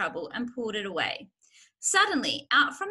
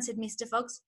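usual speaking voice

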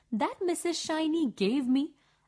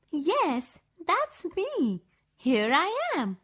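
excited shout; 4 semitones higher than usual. A narrator's reading. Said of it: "I was so excited that I screamed loudly".

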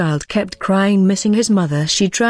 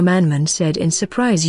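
ordinary delivery, nothing marked

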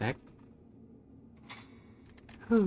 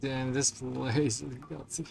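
ordinary talk, nothing marked

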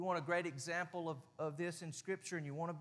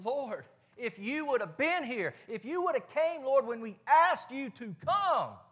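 higher, louder pleading reproach; Martha confronting; 7 semitones higher, 7 dB louder, slower than usual. Retold or imitated. Grieving sister confronting Jesus, higher and louder. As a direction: plead and accuse at once, slow. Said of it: "When Martha confronted him".

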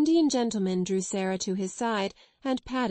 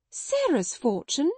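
stunned; 6 semitones higher, slower than usual. A narrator's reading. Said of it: "lost colour".

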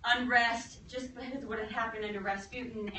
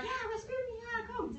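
high-pitched fake-friendly invitation; mock eager coaxing; 12 semitones higher, 6 dB quieter than usual. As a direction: coax the victim, too eagerly. Plotters act eager, coaxing Rasputin to drink.

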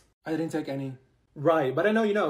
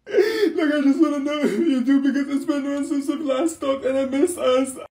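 hammed-up weepy plea, pitched higher; fake-sob it for drama; mock crying; 6 semitones higher than usual. Friend dramatically pleading after ten minutes apart, mock crying.

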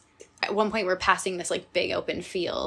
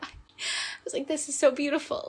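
teary voice; 7 semitones higher than usual. Real, not acted. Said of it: "my crying voice".

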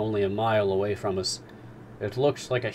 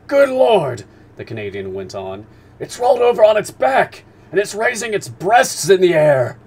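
much higher and louder, wide-eyed exclamation; astonished harpooner; 11 semitones higher, 13 dB louder than usual. Acted. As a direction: shout it like you can't believe your eyes. Ned watching animal roll.

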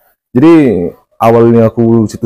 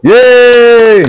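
big high joyful whoop; excited cheer; 6 dB louder than usual. Real, not acted. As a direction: cheer loudly as you're introduced. Guest cheers at his introduction.

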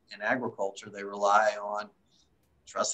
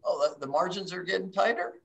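high, rising mock-innocent question; mock surprise; 7 semitones higher than usual. Real, not acted. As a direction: feign surprise at the obvious. Joking about obvious tight margins.

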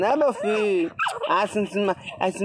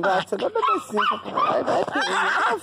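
put-on high squeaky baby talk to the dog; 12 semitones higher than usual. Talking to his pet dog, high baby talk. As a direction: coo at the puppy like a baby.

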